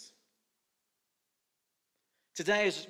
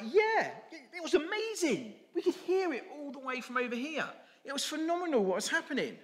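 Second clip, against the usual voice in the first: much higher, eager donkey chorus; other donkeys; 12 semitones higher than usual. Acted. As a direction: gush about the parade like starstruck donkeys. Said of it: "the other donkeys are like".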